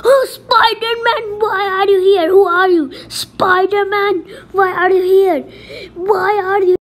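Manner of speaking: hammed-up frightened cry at Spider-Man; crying victim. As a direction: sob it out, calling for help.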